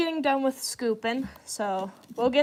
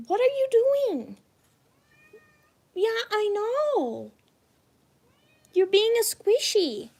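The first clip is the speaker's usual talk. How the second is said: much higher cooing voice to the cat; 10 semitones higher than usual. Real, not acted. Baby talk to her cat.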